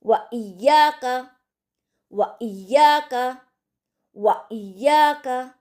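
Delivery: व इय्याका drilled slowly with a glottal catch; light catch. Shown as a demonstration. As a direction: jolt the ई of इय्याका lightly after व. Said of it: "हल्का सा झटका देकर हम पढ़ेंगे".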